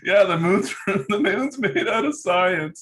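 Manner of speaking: high, laughing through the words. Real, not acted. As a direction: fight back laughter while answering. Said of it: "why are you laughing at me?".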